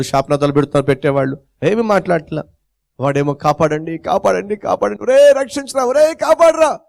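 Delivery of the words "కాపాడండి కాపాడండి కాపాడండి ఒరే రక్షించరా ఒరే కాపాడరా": building from plain to high desperate cries; cries for help. Acted. Drowning boy crying to his friends, building desperate cries. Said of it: "గట్టి గట్టిగా అరుస్తూ".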